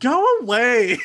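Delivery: high, loud mock yell to go away; hammed-up dismissive yell. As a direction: shout him off in jest. Mocking reply to the son.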